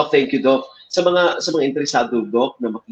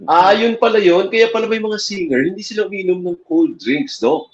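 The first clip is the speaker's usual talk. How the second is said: surprised realization; 9 semitones higher than usual. Real, not acted. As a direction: react as if it just clicked. Host's sudden realization at advice.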